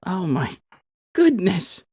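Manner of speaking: sister delighted. Acted.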